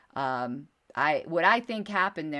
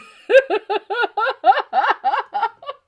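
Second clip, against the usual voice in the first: long, loud, very high peal of laughter; real uncontrollable cackling, on and on; laughing fit; 13 dB louder than usual. Real, not acted. Host losing it over a listener's joke, long laughing fit. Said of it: "I'm gonna get a cramp".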